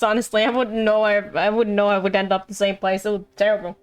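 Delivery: ordinary talk, nothing marked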